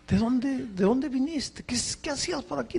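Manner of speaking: high, hurried pleading questions; urgent questions. Retold or imitated. Lost pastor pressing his silent rescuer, high and hurried. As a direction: press him for answers, pitched high and quick.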